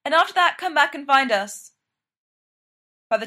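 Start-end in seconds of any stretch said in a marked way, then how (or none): 0.0-1.7 called out louder and higher at the start; raised voice to a departing friend; calling after. A narrator's reading. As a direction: reach someone walking away. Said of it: "Mark calls".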